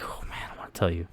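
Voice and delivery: hushed, voiceless aside to himself; eager whisper. Retold or imitated. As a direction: whisper it as if bursting with a secret. Acts out holding back a story.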